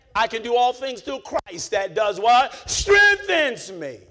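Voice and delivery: loud, high, slow preaching cry; preaching climax. Acted. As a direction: hold strengthens me out for the crowd. Call and response peak.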